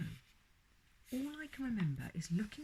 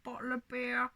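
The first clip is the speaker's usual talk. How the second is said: ventriloquist dummy voice; 6 semitones higher, 7 dB louder than usual. Retold or imitated. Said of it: "I'd say things like".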